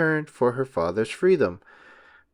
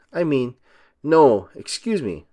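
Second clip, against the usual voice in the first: plain reading voice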